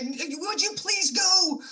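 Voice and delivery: hammed-up would-you-please-go; exasperated plea. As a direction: stretch it out like you've had enough of them. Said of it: "you wanna say".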